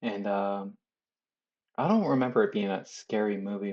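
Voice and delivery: ordinary talk, nothing marked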